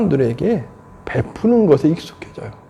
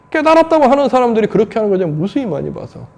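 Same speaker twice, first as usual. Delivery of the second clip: ordinary talk, nothing marked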